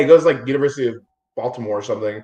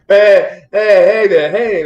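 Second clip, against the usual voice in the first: loud, higher hey, hey there like hailing someone; mock calling out; 6 semitones higher, 7 dB louder, much slower than usual. Swimmer hailed mid-story, mock loud call. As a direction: call it out across the pool, playful.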